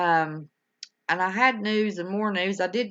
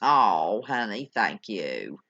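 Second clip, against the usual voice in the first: tender thanks; 11 semitones lower, slower than usual. Real, not acted. Host thanks a sweet viewer, low and tender. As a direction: melt at the kindness.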